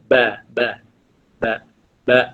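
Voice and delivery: "Ba" with both lips meeting, repeated; teacher demonstrating the letter "ba"; ba demo. Shown as a demonstration. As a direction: drill "ba" over and over. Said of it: "Dua bibir kita bertemu".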